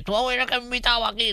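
high-pitched caricature of a fan; mock fan voice. Comedian mimicking people who recognise him, high mock voice. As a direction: imitate a street fan in a silly high voice. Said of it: "te lo dicen todo con esas voces".

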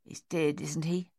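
gruff, breathless whisper. Acted. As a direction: hiss it under the guns. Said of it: "Dave said gruffly".

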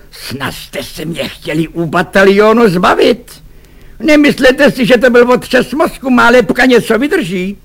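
high, indignant officer's outburst; furious shouting. A narrator's reading. Lieutenant bangs the table and yells at the men, furious. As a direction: yell in outrage, pitch climbing high. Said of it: "rozkřik se".

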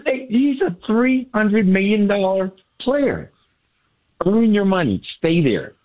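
ordinary talk, nothing marked

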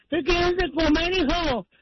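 high, slow and stuffed-up through the nose; nasal gangoso voice. Acted. Mother in the joke asks her son, nasal gangosa voice. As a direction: talk like the nose is blocked, drawn out. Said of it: "Así gangosa habla también".

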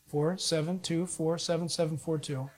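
usual speaking voice

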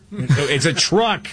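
hammed-up parental correction; mock exasperated correction. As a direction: stretch it out in playful exasperation. Dad correcting the British-speaking child.

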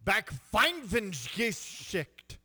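slow hammed-up German word; mock German accent. Shown as a demonstration. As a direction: stretch out the German slowly. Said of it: "how to say".

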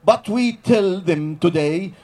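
ordinary talk

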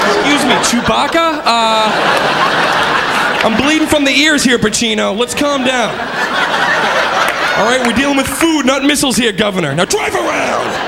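sassy scolding worker. Retold or imitated. Worker scolding the yelling customer.

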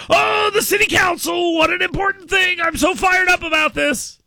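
mock the voters with an exaggerated high wail; mock fired-up zeal. Radio host mimicking voters over council race, mock fired-up zeal. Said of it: "they get all fired up about this".

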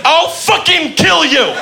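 high, slow squeaky mock threat; quoted threat. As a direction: squeak out the threat like a silly tough guy. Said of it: "Once again, he said".

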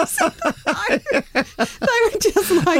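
high laughter spilling into words; laughing hard. Real, not acted. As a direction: crack up again. Laughing at own story.

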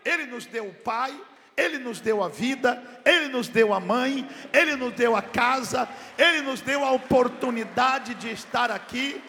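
preaching at full force. Acted. Pastor reminding the church what God gave, preaching at full force. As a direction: hammer each line home to the congregation.

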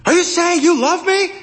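high-pitched yell over a din; yelled question. A narrator's reading. Man yelling to a woman over passing traffic, high yell. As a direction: call it out high, straining to be heard. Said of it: "he yelled".

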